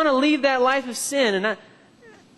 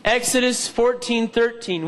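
usual speaking voice

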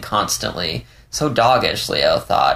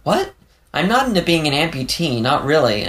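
startled, much higher "what", then protesting; taken-aback protest in a raised voice; sputtering; 7 semitones higher than usual. A narrator's reading. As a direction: blurt it out high, then back-pedal. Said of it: "Leo sputtered".

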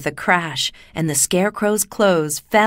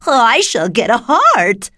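high, loud, drawn-out joy; delighted exclamation; 5 semitones higher, 7 dB louder, slower than usual. A narrator's reading. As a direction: declare it happily as the Tin Woodman. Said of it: "exclaimed the Tin Woodman".

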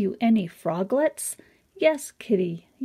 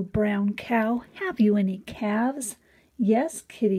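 ordinary delivery

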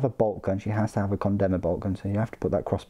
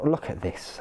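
ordinary talk, nothing marked

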